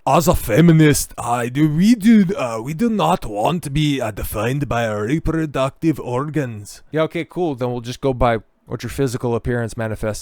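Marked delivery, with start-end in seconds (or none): 0.1-6.8 loud, higher-pitched mock feminist voice; hammed-up feminist caricature; put-on activist accent. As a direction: switch into an exaggerated mocking accent, loud and high. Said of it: "I should update my accent for this".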